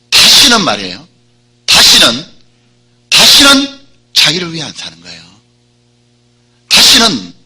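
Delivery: 다시는 hit hard and loud each time; emphatic repetition. Real, not acted. Preacher hammers "again never. (다시는을 힘주어 반복 강조)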